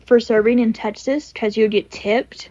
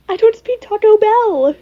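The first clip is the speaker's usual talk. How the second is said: higher, louder mock confused customer; a higher, louder put-on voice for the joke; 10 semitones higher, 6 dB louder than usual. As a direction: toss it off as a silly confused voice. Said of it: "it's giving the same energy".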